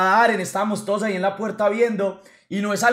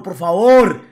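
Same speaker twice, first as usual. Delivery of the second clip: por favor shouted louder as a desperate plea; loud begging; 11 dB louder, slower than usual. Retold or imitated. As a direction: beg hard on the second por favor. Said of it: "préstame la cama pa' culiar".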